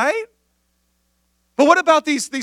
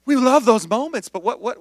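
normal talking voice

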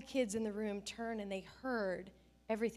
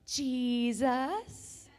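put-on sing-song child's rising answer; little girl answer; 6 dB louder than usual. Little daughters answering their dad, sing-song child voice. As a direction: answer sweetly like a small girl posing. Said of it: "they go".

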